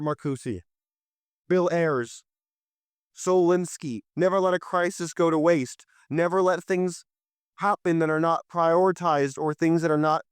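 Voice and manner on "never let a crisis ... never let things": usual speaking voice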